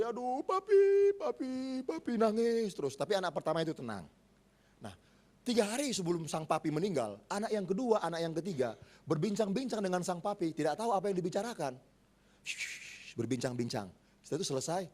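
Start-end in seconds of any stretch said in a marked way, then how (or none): 0.0-2.2 put-on high, slow whimpering wail of a weeping child; crying child. Preacher imitating a crying child calling for his dying father, wailing. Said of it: "nangis terus tiap hari". 12.4-13.1 whisper shush. Retold or imitated. Children whispering with father.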